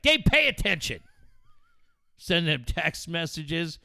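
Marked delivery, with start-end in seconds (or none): 0.0-1.0 exasperated. Retold or imitated. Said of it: "today I just was like".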